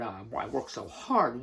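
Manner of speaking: slow, low mock whine of a hard worker; sneering at people who say it; mocking quote. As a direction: drag it out like a tired complainer. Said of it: "people use the word".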